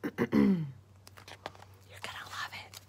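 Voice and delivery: slow unvoiced whisper. Real, not acted. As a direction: whisper the reveal teasingly. Teaser whisper.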